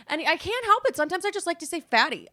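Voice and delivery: high, loud mock plea; playful self-defence. Playful plea after scolding herself.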